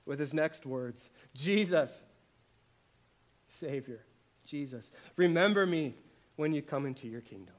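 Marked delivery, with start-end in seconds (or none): 1.3-7.5 loud, urgent plea to Jesus; pleading prayer. Acted. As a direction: cry out the prayer with force, pleading. Voicing the thief's prayer.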